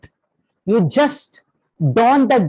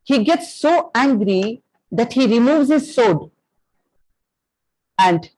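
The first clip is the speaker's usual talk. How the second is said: usual speaking voice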